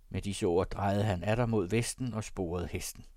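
ordinary delivery, nothing marked

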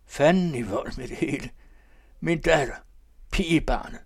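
high, loud, tipsy babbling; drunken old man. Acted. The old man lolling on the sofa, drunk and babbling. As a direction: ramble like a merry drunk.

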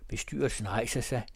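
ordinary delivery, nothing marked

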